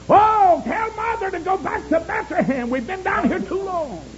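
high wailing pulpit cry; high-pitched dying plea, preached; impassioned preaching plea. Acted. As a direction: open with a loud cry and stay high.

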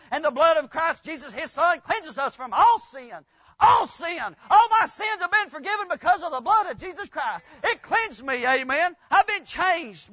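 loud, rising revival preaching; fiery preaching. Acted. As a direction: preach it at full force to the congregation. Preaching at full force.

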